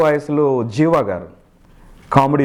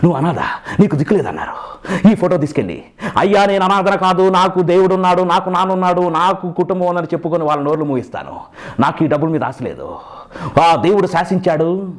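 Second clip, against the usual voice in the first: Arunachalam film hero; 3 semitones higher than usual. Acted. Mimic acting the Arunachalam hero refusing money, theatrical hero voice. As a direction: punch out the movie hero's speech, with a stylized laugh. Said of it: "అరుణాచలంలో".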